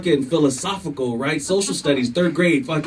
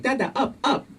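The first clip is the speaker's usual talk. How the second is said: put-on toddler voice; 10 semitones higher, 4 dB quieter than usual.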